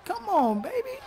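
ordinary talk, nothing marked

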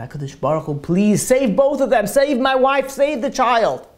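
desperate plea building to high and loud; retold tearful prayer. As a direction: beg heaven, rising into an acted cry. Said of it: "he started crying again".

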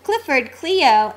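ordinary delivery

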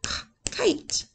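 "kite" whispered with a crisp k; soft unvoiced "kite"; whispered k sound. Shown as a demonstration. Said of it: "It's our k".

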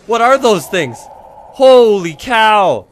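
high, loud, stretched exclamation; alarmed cry. Real, not acted. Player chased by unknown creatures, alarmed cry. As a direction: cry out at the frightening sight.

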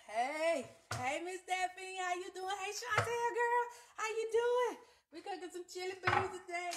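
light, lifted welcome; cheerful greeting. Real, not acted. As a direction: greet each friend by name, all smiles. Greeting viewers on live.

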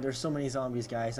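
ordinary talk

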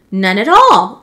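loud, emphatic denial; iguana's cry. A narrator's reading. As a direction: cry out in protest as the accused iguana. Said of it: "cried the iguana".

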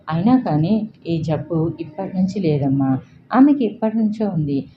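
ordinary delivery, nothing marked

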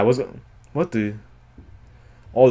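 usual speaking voice

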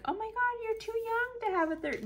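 high, squealing mock gush; gushing stranger. Imagined stranger flattering her youth, high mock gush.

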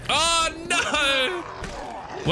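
very high, drawn-out cry of dismay; panicked scream. Real, not acted. Gamer sure they're dead, high panicked scream. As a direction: cry out high as disaster hits. Thinks they are dead.

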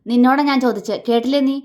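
loud, high, irate questioning; angry demand. A narrator's reading. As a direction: demand an answer angrily. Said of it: "അവൻ ദേഷ്യത്തോടെ ചോദിച്ചു". (ദേഷ്യത്തോടെ ഉച്ചത്തിൽ ചോദിക്കുക)

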